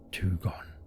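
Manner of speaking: soft eerie whisper; strange whisper. A narrator's reading. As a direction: breathe it out like a dark secret. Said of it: "his voice lowering strangely".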